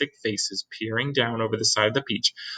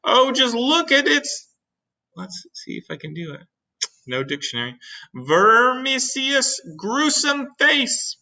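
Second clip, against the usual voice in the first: horrified cry; 3 dB louder than usual. Acted. Chief horrified.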